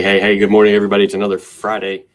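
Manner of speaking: normal talking voice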